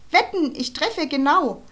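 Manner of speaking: put-on bully boy calling out his boast; boastful call. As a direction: brag it like a show-off bully.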